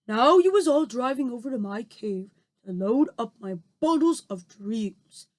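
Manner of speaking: lightly voiced friendly giant explaining; BFG voice. A narrator's reading. Giant explains to Sophie in his giant voice.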